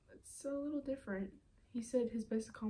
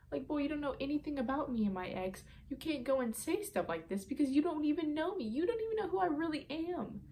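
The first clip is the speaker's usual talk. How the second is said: higher-pitched indignant retort; 6 semitones higher than usual. Retold or imitated. Girl telling off a guy who doubts her relationship, indignant. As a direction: fire back, pitch climbing to the end. Indignant comeback in retold text quarrel.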